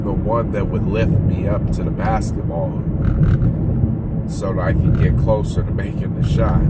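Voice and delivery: slow, halting, with long pauses; slow speech. Real, not acted. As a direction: let each phrase come slowly. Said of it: "Sorry I'm speaking so slow".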